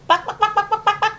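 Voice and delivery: high clucking buck buck; chicken clucking. Shown as a demonstration. Imitating chicken for toddler.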